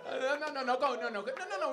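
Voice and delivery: high, loud no no no; loud protest. Real, not acted. Comic loudly stopping the crowd from singing.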